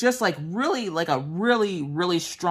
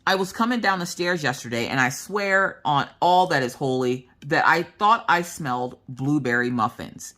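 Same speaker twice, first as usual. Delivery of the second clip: usual speaking voice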